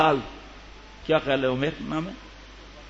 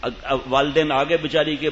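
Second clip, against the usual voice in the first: usual speaking voice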